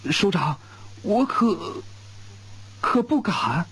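high, stammering shock; stunned stammer. A narrator's reading. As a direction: caught off guard by the marriage offer. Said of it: "吴根柱惊得眼珠子都要掉出来了".

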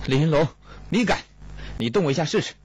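high-pitched, outraged challenge; furious. A narrator's reading. Kong dares Li to lay a hand on him, enraged. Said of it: "孔杰暴怒道".

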